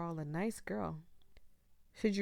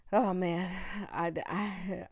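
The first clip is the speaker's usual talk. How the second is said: ordinary talk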